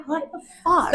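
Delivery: high-pitched retold bafflement; baffled. American retelling her reaction to friend, baffled. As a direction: squeak it in disbelief. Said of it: "I'm like".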